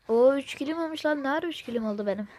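high, loud surprised exclamation; surprised outburst. Real, not acted. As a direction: blurt it out in disbelief. Surprised at own kill count.

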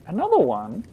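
high, loud incredulous question; startled disbelief. Real, not acted. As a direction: exclaim it, caught off guard. Player startled by another token.

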